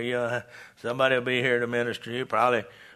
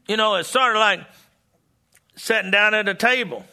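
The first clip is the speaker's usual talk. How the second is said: higher, louder revival preaching; preaching at full force; 7 semitones higher, 3 dB louder than usual. Acted. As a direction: drive it home to the congregation. Preacher drives home sermon point.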